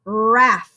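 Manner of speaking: "Raff" alone, ending on a held f; "Raff" set apart for a listening speller; isolated first syllable. Shown as a demonstration. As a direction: draw out Raff so the final f is heard. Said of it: "last thing you hear in the first syllable".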